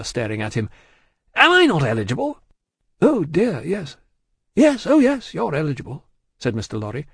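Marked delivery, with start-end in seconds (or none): 1.4-2.4 high, loud, quick question; affronted and forceful; indignant demand. A narrator's reading. Stryver indignantly demands agreement. 3.0-6.1 high, fussy agreement; anxious-to-please banker; placating. A narrator's reading. Lorry hastily placates Stryver.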